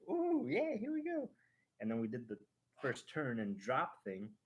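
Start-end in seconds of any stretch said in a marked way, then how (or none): 0.1-1.3 put-on much higher, thrilled little-kid voice; excited little kid. Little Kimo as the coaster climbs, thrilled kid voice. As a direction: squeal it like a small kid starting the ride. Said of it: "he was a little kid, like".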